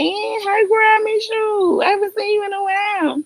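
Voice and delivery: high squealing hello to a fan; high-pitched delighted greeting to a fan; excited greeting. Real, not acted. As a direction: gush over a missed friend, up high. Greeting a viewer fondly.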